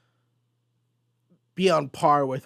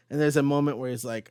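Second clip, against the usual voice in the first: ordinary talk, nothing marked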